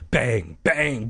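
ordinary delivery

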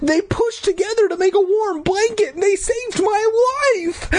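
high, impassioned insistence; emphatic protest. A narrator's reading. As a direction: plead their case at a high pitch. Child protests, defending the creatures.

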